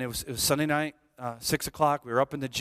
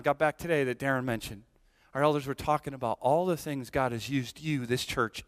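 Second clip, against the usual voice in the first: usual speaking voice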